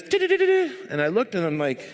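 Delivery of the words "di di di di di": high, rapid stuttered clatter; imitated rattling noise. Retelling a noise heard at the door, high stutter. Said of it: "and I heard this".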